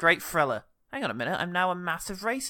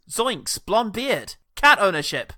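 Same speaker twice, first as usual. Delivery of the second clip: impersonating co-host; 6 semitones higher, 5 dB louder, much slower than usual. Pretend. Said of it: "I'm him and he's me".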